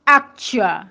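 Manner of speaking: "actual" with a clear K before ch; full consonant cluster. Shown as a demonstration. Teacher reminds learners to keep the K, cluster in full. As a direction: say "actual" as ak-chual, nothing dropped. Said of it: "K is there before Ch".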